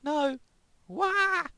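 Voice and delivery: terrified screams. Acted. Character screaming for help.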